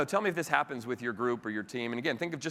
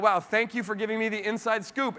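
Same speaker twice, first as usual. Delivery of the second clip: mock grateful; 7 semitones higher than usual. Newcomer thanking the colleague for gossip, gushing mock thanks. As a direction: gush like a thrilled newcomer. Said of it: "you're really grateful".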